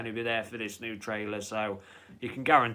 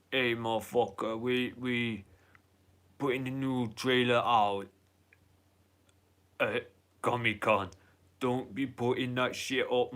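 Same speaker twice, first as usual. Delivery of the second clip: put-on amateur Austrian action-star accent; rough, put-on Austrian accent; Arnold Schwarzenegger. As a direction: do a bad Austrian accent, catchphrase and all. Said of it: "That's my Arnold accent".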